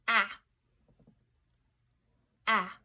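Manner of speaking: "ah" as an isolated vowel, loud and clear; sound dictation. Shown as a demonstration. Teacher dictating sounds for pupils to write, loud isolated "ah". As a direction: give a short, loud "ah", pause, repeat it. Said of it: "our sound dictation".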